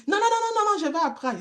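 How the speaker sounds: voix d'enfant haut perchée et pressée; enfant empressé. Retold or imitated. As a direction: imite un petit garçon impatient d'apprendre. Enfant répond qu'il va apprendre. (voix d'enfant aiguë et empressée)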